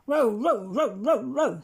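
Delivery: loud rapid barks; barking dog. A narrator's reading. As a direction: bark over and over. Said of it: "said Bottomly Wat".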